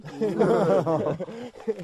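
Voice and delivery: loud high laugh; burst of laughter. Real, not acted. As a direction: crack up loudly.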